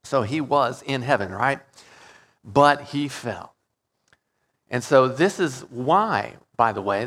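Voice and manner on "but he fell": slow dramatic. Real, not acted. Dramatic pause before fall.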